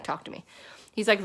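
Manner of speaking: usual speaking voice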